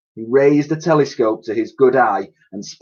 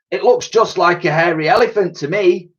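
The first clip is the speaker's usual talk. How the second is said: put-on old soldier voice; 8 semitones higher, 3 dB louder than usual. Old man voice.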